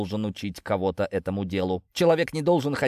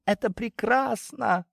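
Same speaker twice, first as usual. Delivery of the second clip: much higher and slow, rapturous; high blissful voice; 12 semitones higher, slower than usual. A narrator's reading. Cat pretending to fly.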